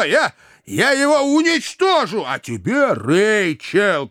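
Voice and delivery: high-pitched, spluttering indignation; worked-up angry gentleman; furious threats. A narrator's reading. Brother threatens suit, rounds on sister.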